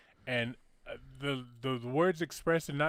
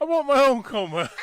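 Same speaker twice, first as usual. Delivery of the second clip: higher, louder comic repeat; a comic demand repeated louder for laughs; louder joking repeat; 9 semitones higher, 9 dB louder than usual. Real, not acted. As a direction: punch the joke home the second time.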